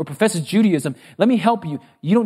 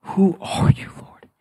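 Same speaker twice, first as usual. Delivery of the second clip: whispered, stunned question; hushed to almost nothing on "Lord"; awed whisper; slower than usual. Acted. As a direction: whisper it in humbled disbelief. Saul's stunned question after long pause.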